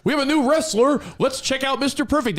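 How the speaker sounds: over-the-top wrestling promo read; announcer voice. Retold or imitated. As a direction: sell the new wrestler like a TV announcer.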